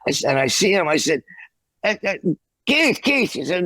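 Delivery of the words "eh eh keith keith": calling out. Retold or imitated. Calling out to Keith in hall.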